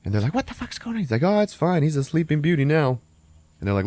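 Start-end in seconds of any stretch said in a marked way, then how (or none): 0.3-1.0 high-pitched, hushed-level disbelief; mock panicked question; squeaky startled reaction; alarmed questioning. Burglars react to the yell. 1.2-3.0 high, sing-out brush-off; lightly acted cocky reassurance; breezy dismissal. Burglar brushes off partners' alarm.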